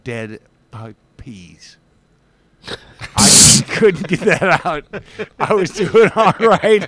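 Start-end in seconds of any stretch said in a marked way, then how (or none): 2.6-6.9 higher, louder, through laughter; laughing. Real, not acted. Explaining to friends why the words stuck, laughing. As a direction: crack up while owning the stumble. Cracks up after stumbling on words.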